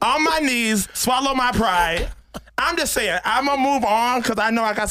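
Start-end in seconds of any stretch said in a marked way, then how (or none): 0.0-2.1 "swallow" drawn out slowly and stressed; emphasis on the lyric. Pretend. Host stresses the lyric to the cohost, drawn out on "swallow". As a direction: lean hard on "swallow my pride". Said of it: "You put the emphasis on the".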